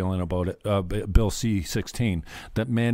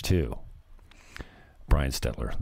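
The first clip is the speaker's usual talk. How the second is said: ordinary talk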